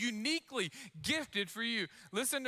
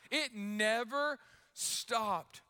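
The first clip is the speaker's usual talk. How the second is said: slow emphasis; much slower than usual. Retold or imitated. Mom describing endless energy.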